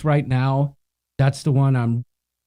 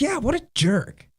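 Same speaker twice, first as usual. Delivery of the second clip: mock agreeing; 9 semitones higher, 3 dB quieter than usual. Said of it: "She's like".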